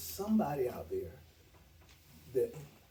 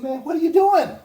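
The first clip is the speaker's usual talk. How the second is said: much higher, much louder disbelieving cry; amazed shout; 13 semitones higher, 17 dB louder than usual. Retold or imitated. Colleague Fred amazed at the busy office, loud high shout. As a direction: belt it out like you can't believe it.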